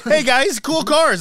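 high loud eager greeting; eager astronaut. Retold or imitated. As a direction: try too hard to fit in. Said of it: "He comes over in a little Rover".

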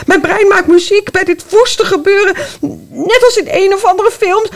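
panicked running. Acted.

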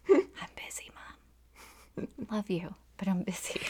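furtive whisper into the phone; hushed whisper. Retold or imitated. Daughter at work answers mom's repeated calls, hushed whisper. Acting out answering mom while busy.

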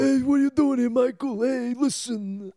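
high, loud, slow old man impression. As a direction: wheeze it like an old mobster. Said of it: "me impersonating an old man".

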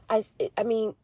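ordinary talk

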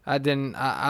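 ordinary talk, nothing marked

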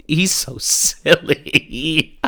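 slow words breaking into real laughter; laughing. Real, not acted. Host cracking up over a silly video, laughing. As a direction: crack up while drawing it out.

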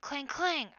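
two high, loud clangs; imitated metal clang. A narrator's reading. Narrator sounding a dagger on the portcullis, loud clangs. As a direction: voice repeated blade strikes on iron.

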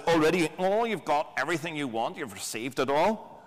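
sarcastic mock admiration; Paul. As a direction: gush sarcastically over all they supposedly have. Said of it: "he would do that voice".

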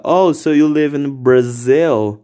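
a slow American tourist question; lightly voiced gringo asking; gringo English. A foreigner asking a Brazilian, slow mild gringo voice. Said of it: "ele fala".

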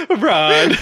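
voice cracking up an octave. Retold or imitated. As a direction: redo the crack on purpose, laughing.

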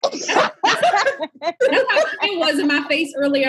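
giggling through the words; laughing hard mid-sentence; laughing while talking. Real, not acted. Laughing while teasing friend.

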